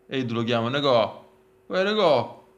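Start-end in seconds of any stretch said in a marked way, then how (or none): 1.7-2.4 calling out, dialect style; southern Italian accent. Retold or imitated. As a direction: hail a friend Neapolitan-style. Calling out to Nicò.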